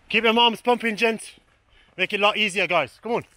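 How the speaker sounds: loud, high instruction; coaching shout. Real, not acted. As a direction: bark the tip across the field.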